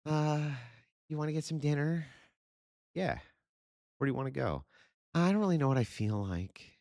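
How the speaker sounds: high mock girlfriend voice; mocking a woman. Mocking a girlfriend choosing dinner, high and coy. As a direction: lift into a coy girlfriend impression. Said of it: "Like when they go".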